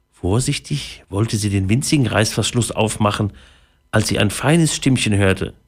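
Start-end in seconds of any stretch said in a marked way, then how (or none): none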